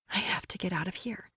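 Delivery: breathy urgent whisper. A narrator's reading. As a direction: whisper it to yourself, scared. Panicked inner thought.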